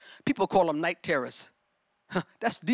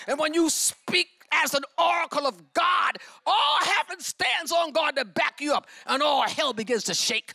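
preaching at full force; 5 semitones higher than usual. Acted. Preacher calling out to the congregation, full force. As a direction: preach it out to the church.

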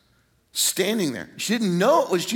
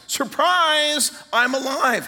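high, drawn-out announcement of surprise; spring the big reveal, pitched way up; exultant acted reveal; joyful surprise; 9 semitones higher, 3 dB louder, slower than usual. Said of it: "this is a huge surprise".

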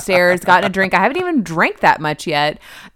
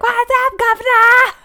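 very high, hammed-up British accent; British man impression; 5 dB louder than usual. Retelling someone copying her husband's impression, high mock British accent. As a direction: put on a silly British accent. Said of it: "did your British man impression".

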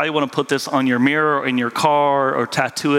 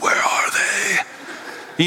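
slow, breathy panicked whisper hunting for lost keys; frantic whisper; 4 semitones higher, much slower than usual. Retold or imitated. Pastor acting out losing his keys, frantic slow whisper. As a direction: whisper it like the keys are gone for good. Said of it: "I'm like".

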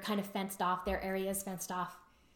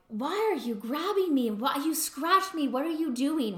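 imitating an upset husband, higher-pitched; mildly indignant retold protest, raised pitch; kind of upset; 6 semitones higher, 4 dB louder than usual. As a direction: act out a startled partner's complaint. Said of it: "He was kind of upset".

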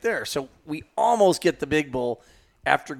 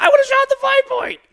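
much higher, louder mocking voice; mock client whine; 8 dB louder than usual. Guide mimicking the client's excuse, high and mocking. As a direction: squeak it out like a sulky client. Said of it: "And he goes".

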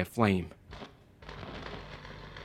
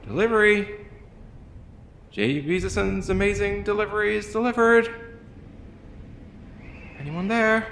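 high, cheery delivery call; 12 semitones higher than usual. A narrator's reading. Courier calling into the empty halls, high and bright. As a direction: sing out the delivery announcement.

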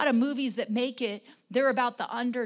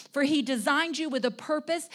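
usual speaking voice

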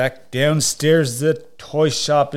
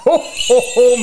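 loud high Santa laugh; jolly ho ho ho; 11 semitones higher, 8 dB louder than usual. Acted. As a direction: boom a hearty ho ho ho.

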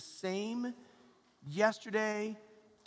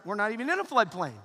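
loud, smug bragging; 7 dB louder, faster than usual. Pretend. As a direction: brag about every policy. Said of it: "Or you may be like".

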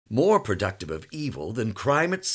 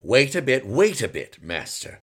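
louder firm father holding off; firm, fending the boy off; stern father; 3 semitones higher, 7 dB louder than usual. A narrator's reading.

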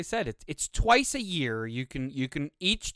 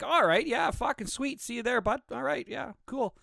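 easygoing agreement; 9 semitones higher than usual. Retold or imitated. His friend's casual reply, acted out.

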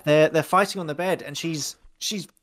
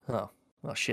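muttering Glenn; 5 semitones lower, 9 dB quieter than usual. Retold or imitated. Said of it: "you just see Glenn like".

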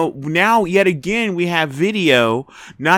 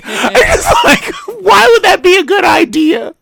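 high, loud disbelief; can't believe it; pitched-up outrage; incredulous; 7 dB louder than usual. Real, not acted. Exasperated at the defense.

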